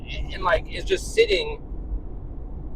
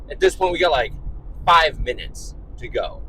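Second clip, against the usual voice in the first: ordinary talk, nothing marked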